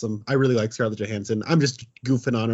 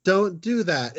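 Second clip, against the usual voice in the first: higher, melodramatic mock scolding; overcooked acting; 6 semitones higher, much slower than usual. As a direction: imitate a hammy movie mom. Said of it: "overcooked performance".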